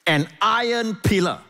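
high, slow, weighty proclamation; scripture declamation. Acted. Declaims God's words from scripture.

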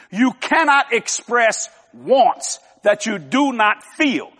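slow dictation with spaced-out words; slow, deliberate. Shown as a demonstration. Preacher repeating a point for note-takers, slow and deliberate. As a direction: say it slowly enough to write down. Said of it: "I'm gonna say it slow".

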